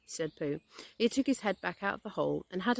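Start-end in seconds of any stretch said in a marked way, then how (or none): none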